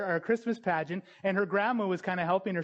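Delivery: ordinary talk, nothing marked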